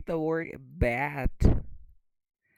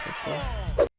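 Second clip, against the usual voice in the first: mock Australian "of course", breathy and higher; Australian accent; 8 semitones higher than usual. Shown as a demonstration. Teacher jokes in an Australian accent. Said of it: "this is Australian English".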